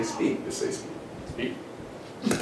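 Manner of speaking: whispered, impatient speak; cheeky whisper. Acted. Saul whispering at Samuel to get on with it, cheeky. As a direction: hiss it like a kid daring the prophet. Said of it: "he's had the audacity to say".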